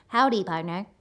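monotone robot voice doing a cowboy; Stephen Hawking as a cowboy. Acted. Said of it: "impression of Stephen Hawking doing an impression of a cowboy".

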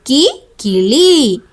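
put-on very slow, each sound drawn out for children; slow letter demo. Shown as a demonstration. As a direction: spell out കീ then കിളി, slowly. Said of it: "കിളിയാണ് കേട്ടോ കീ വെച്ചിട്ട്". (കീ നീട്ടി, കിളി പതുക്കെ)

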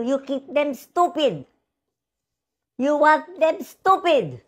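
high, heated scolding of politicians; rising, heated rebuke that slows at the end; angry accusation. Real, not acted. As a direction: call out the corrupt politicians, pitched high. Berating politicians.

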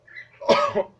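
harsh choking cough; coughing fit. Real, not acted. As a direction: cough hard on dry powder. Coughing after a spoon of cinnamon.

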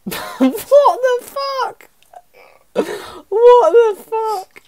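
high, slow shrieking what the fuck; shrieked disbelief. Real, not acted. Watching his teen video, shrieking in high, stretched-out disbelief.

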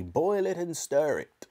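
slow, high, urging order; excited cry. A narrator's reading. Mister Cranky urging George at the saucepan, slow excited cry. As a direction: stretch out the eager order. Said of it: "cried Mister Cranky".

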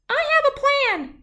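a tiny shrew proposing teamwork. Acted. Shrew offers New to work together, tiny high voice.

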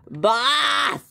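high-pitched drawn-out call. Acted. Worker summoning the boss, calling out. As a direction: holler for someone in the back. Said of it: "I just yell at my, um, worker".

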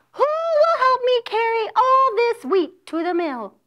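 high-voiced hen pleading for help; hen asking. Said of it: "She asked".

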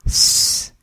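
"s," as a long voiceless hiss; "s," held as a clean voiceless hiss; s sound demo. Shown as a demonstration. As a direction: hiss the "s," alone. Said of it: "S pronounced as in the word sister".

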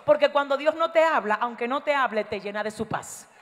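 ordinary delivery

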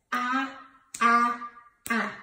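"Ah" as a short, separate vowel sound; letter sound demo. Shown as a demonstration. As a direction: sound out "Ah" three times with pauses. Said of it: "Allie Alligator says".

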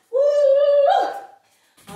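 high loud playful woofs; dog barking. Pretend. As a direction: bark like a happy dog. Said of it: "pretend you're a doggy".

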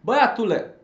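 put-on loud, high calling out to a boy. Shown as a demonstration. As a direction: call the boy across the room. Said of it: "dar arată o strigare".

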